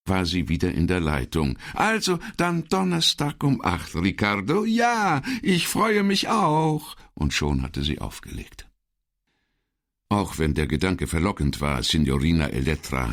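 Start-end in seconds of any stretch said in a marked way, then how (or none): 1.7-6.9 very high cooing woman's voice, slowing at the end; high twittering farewell; chirping sweetly. Acted. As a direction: sign off like a fond lover. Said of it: "zwitscherte sie".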